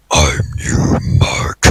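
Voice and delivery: low, raspy whispered villain; evil double voice. Acted. The double taunts Mark, low raspy whisper. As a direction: breathe it out like Satan. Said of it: "The mysterious man laughed".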